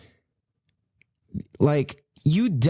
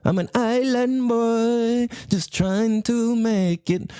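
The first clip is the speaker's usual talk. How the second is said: put-on slow, high, laid-back island boy drawl; higher, slower mock island boy; Florida island boy; 8 semitones higher, slower than usual. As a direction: play the chill Florida island dude, stretched out. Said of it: "Or if you're from Florida".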